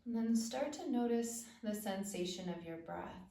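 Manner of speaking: calm, spacious phrases with long pauses; unhurried body-awareness meditation voice; guided meditation. Acted. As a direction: guide attention gently through the body.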